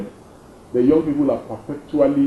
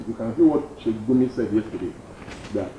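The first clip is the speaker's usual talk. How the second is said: ordinary talk, nothing marked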